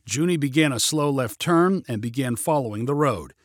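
ordinary delivery

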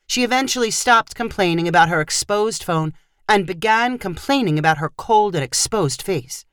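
usual speaking voice

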